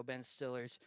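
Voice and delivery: usual speaking voice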